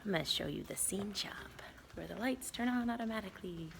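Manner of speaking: whispering. Real, not acted. Quiet tour of empty theater.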